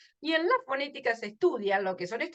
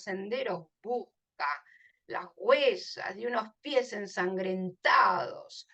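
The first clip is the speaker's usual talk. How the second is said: poem recitation; 4 semitones lower, 4 dB quieter than usual. Acted. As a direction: let each line fall away softly at the end. Reads the poem aloud.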